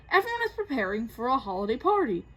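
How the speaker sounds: usual speaking voice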